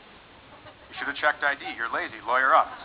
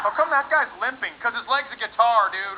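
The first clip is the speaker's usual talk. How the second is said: put-on excited kid; 6 semitones higher than usual. Cheerful onlooker voice.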